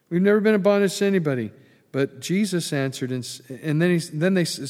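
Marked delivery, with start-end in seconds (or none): 0.1-1.5 mock boast.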